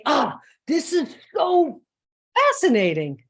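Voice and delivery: loud exasperated groan turned into wonder; mock frustration. Coach swapping frustrated for fascinating, mock frustration. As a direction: start fed up, land hard on "fascinating". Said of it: "Anybody get frustrated?".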